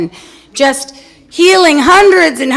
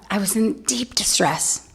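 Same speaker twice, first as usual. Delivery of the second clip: whisper; 7 dB quieter than usual. Real, not acted. Whispered aside.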